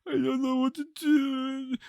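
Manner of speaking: high, slow, whimpering fake sob; mock fake crying. A spy fake-crying on the phone to her friend, high whimpering. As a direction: put on a pitiful crying voice to fool her. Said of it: "fake crying this episode".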